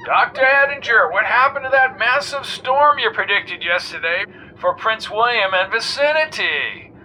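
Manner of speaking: jaunty talk-radio host. A narrator's reading. Arlo Wombat quizzing the weatherman on air, breezy host.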